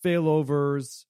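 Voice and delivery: usual speaking voice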